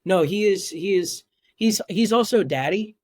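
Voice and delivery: usual speaking voice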